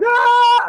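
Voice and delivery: put-on loud, high kid's scream; terrified scream. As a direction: scream into his face. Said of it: "I just scream into his face".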